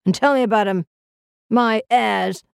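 aged, mocking relish; crotchety old voice with a hint of a cackle; cackling old man. A narrator's reading. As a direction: cackle it like a scornful old invalid. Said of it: "he cackled".

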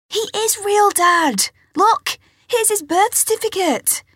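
high, loud protest; insistent. Acted. Girl insists to father.

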